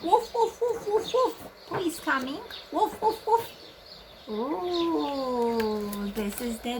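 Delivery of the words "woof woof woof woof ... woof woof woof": dog bark. Shown as a demonstration. Imitating dog for children.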